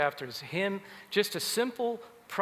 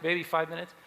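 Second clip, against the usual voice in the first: ordinary delivery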